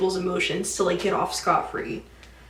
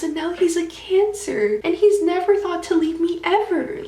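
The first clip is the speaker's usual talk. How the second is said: psycho astrology lady; 7 semitones higher than usual. Retold or imitated. Dream leader praising Cancers to the girl, high whisper building to an unhinged gush. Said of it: "like, going a little psycho".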